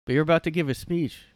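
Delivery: high, loud wake-up shout; mock yell. An aide wakes Biden for his speech, shouting. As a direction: jolt him awake.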